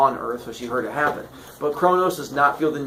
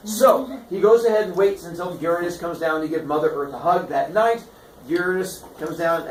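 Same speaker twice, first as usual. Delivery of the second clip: plain reading voice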